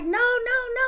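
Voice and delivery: high, frantic family shouting no; panicked yelling. Retold or imitated. Family in the background begging the dad to stop, high panicked cries. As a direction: cry out high to stop the fight. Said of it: "all in the background yelling like".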